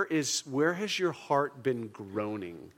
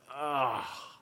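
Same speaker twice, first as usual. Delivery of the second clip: short sighing groan; heartfelt groan, let out on a sigh; pained groan; 4 dB quieter than usual. Shown as a demonstration. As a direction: groan as if wanting it over. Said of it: "that's groaning".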